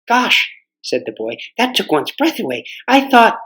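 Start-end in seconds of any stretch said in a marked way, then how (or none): 0.1-0.5 put-on surprised boy. Boy voiced in story. 1.6-3.4 put-on young boy. Boy voiced in story.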